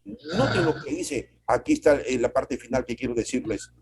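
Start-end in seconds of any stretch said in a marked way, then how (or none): none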